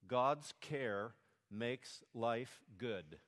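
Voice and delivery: slow and deliberate, word by word; slow teaching outline. Shown as a demonstration. Pastor slowly giving children the first point. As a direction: spell it out slowly for the kids. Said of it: "Number one".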